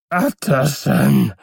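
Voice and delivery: slow, high, drawn-out plea; eerie, stretched-out cry for help; voice calling from inside. A narrator's reading. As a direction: wail the name in a strange altered voice. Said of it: "rief eine Stimme von innen".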